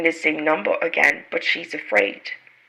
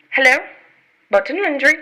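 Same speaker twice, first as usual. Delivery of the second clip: anxious phone hello; 7 semitones higher, 5 dB louder, faster than usual. Acted. Jane calling on phone.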